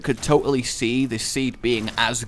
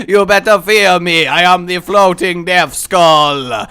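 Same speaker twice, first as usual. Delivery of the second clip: loud, slow, sing-song spooky threat; death skull voice; 5 semitones higher, 10 dB louder, slower than usual. Pretend. Gamer voicing his floating skull character, menacing and sing-song. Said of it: "that is definitely how a floating death skull speaks".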